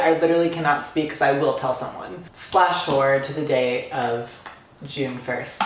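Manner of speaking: ordinary delivery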